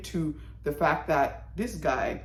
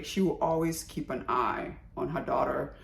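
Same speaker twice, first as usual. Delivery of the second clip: ordinary delivery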